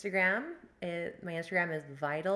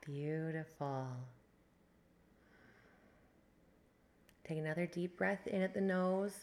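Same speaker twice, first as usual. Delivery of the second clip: guided meditation. Acted. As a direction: ease them into the breath. Leads a breathwork meditation.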